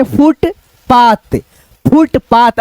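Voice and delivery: Footpath drawn out slowly, joining two syllables. Shown as a demonstration. Teacher showing learners how to join syllables slowly. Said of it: "ऐसे करके बोल देना".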